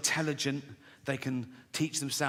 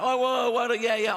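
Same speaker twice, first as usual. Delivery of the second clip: higher, louder show-off whoop; mock excited; 8 semitones higher, 5 dB louder than usual. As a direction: ham up their self-congratulation. Mocking bragging media types.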